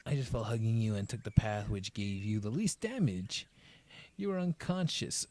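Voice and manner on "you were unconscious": stunned realization. Acted. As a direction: let it dawn on her. Restated louder right after.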